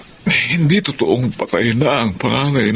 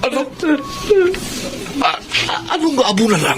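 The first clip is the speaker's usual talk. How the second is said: shocked disbelief; 14 semitones higher, faster than usual. Acted. Father shocked by the news.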